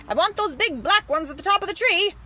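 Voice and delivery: loud, high demand; shouting. Acted. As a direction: shout orders up into a tree. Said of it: "the man was shouting".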